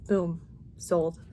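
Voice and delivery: ordinary talk, nothing marked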